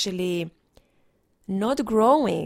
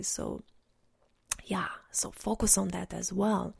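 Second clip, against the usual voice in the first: whisper; 5 dB quieter than usual. Real, not acted.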